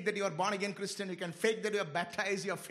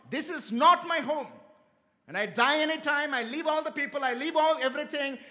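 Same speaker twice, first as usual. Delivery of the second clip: firm, slightly raised sermon proclamation; preaching declaration; 3 semitones higher, 4 dB louder than usual. Acted. Preacher declaring to the church that heaven is home, fervent. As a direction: proclaim it like a creed.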